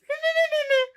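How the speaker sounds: squeaky sped-up giggle; high chipmunk giggle. Retold or imitated. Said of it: "I sound like a chipmunk".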